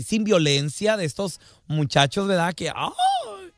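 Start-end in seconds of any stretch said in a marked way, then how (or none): 2.5-3.5 mock effeminate shriek. Joke: frightened effeminate young man.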